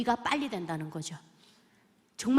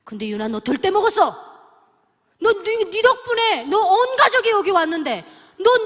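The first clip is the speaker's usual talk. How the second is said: scolding in anger; 7 semitones higher, 9 dB louder than usual. Retold or imitated. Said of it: "갑자기 화내시는 것 같은 거예요".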